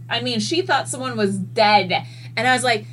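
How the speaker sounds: usual speaking voice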